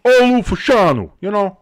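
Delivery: Olu Fashanu, high and loud in a Jersey accent. Shown as a demonstration. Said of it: "I'm saying it with my Jersey accent".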